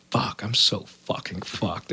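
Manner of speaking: slow, soft whisper to himself; whispered despair. Retold or imitated. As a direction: breathe it out, slowly. Despairing to himself under breath.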